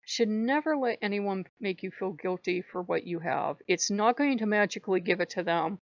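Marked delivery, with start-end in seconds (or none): none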